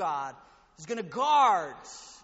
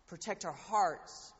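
ordinary delivery

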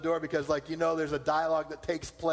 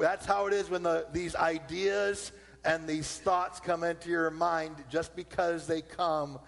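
ordinary talk, nothing marked

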